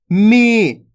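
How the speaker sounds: "मी" with a long, held ee; drawn-out vowel. Shown as a demonstration. Teacher answering for pupils, drawn-out "मी". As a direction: stretch the ee of "मी". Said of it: "खींच खींच के उच्चारण करना है".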